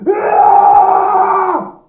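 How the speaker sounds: loud high scream; big piercing scream. Acted. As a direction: let out a full scream. Screaming in game after "my time to scream.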